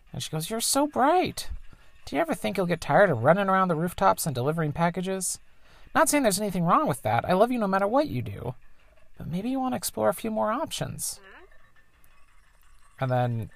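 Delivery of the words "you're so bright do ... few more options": higher, lightly put-on mother voice; mom's voice. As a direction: play the tinkering engineer mom, a bit higher. Voices the mom.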